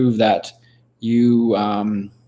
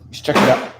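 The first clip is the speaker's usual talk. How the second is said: usual speaking voice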